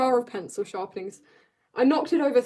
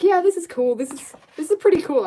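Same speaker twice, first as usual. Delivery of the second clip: mock excited thought; 6 semitones higher, 5 dB louder than usual. Imitating own past thought.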